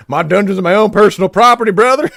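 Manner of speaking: loud, high-pitched mock outburst; mock-indignant shout. Streamer answering chat about their dungeon, hammed-up shout. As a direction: bellow it high like a hammed-up wrestler.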